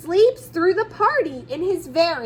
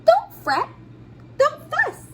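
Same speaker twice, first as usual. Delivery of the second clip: high, squeaky little mouse voice; a mouse comforting the bear, high and squeaky; 11 semitones higher than usual. Acted. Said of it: "Mouse squeaks".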